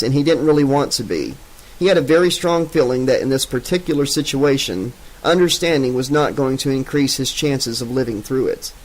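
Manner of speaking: ordinary delivery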